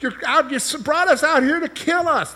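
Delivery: very high, mock-whining complaint; whiny Israelites. Preacher mimics Israelites whining at God, very high. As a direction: whine it like a spoiled child. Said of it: "they kept murmuring against God, like".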